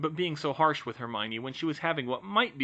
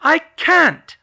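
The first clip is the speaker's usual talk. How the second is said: high, loud cry of protest; frantic refusal; 15 dB louder than usual. Acted. Hermione refusing George, loud and high. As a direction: fling the refusal at him.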